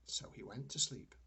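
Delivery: ordinary delivery, nothing marked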